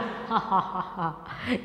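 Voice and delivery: soft, low mock laughter; imitated laugh. Teacher acting Leonard under the spell, soft mock laughing. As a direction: act out a low, quiet laugh.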